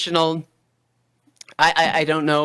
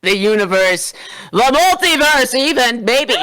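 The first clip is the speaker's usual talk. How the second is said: building grandeur; 6 semitones higher than usual. Acted. Building louder.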